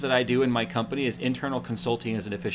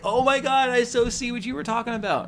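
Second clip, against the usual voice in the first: impressed realization; 10 semitones higher than usual. Retold or imitated. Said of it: "he's like".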